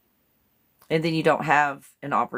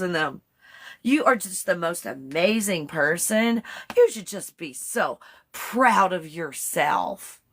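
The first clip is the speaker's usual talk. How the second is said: put-on butter them up like a bubbly Valley Girl; 5 semitones higher than usual. Vlogger playing Chat GPT flattering a user in Valley Girl voice. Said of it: "I'm pretending to be Chat G P T".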